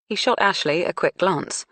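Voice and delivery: plain reading voice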